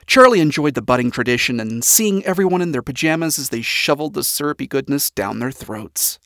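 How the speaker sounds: ordinary delivery, nothing marked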